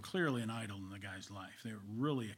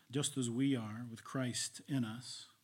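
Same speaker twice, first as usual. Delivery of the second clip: ordinary delivery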